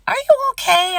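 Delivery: high, gentle concerned voice; lightly voiced caring manager asking. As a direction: VP checking on a stressed worker, concerned. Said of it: "he was like".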